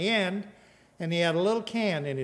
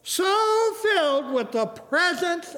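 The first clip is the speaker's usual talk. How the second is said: raised pitch, stretched-out proclamation; emphatic preaching; 6 semitones higher than usual. Real, not acted. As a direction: build to a ringing climax. Preaches at full force.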